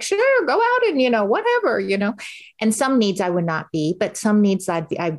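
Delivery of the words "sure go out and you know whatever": much higher, airy husband impression; lightly mocking husband, high and casual; breezy husband. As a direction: toss it off like an easygoing husband. Husband voiced in story.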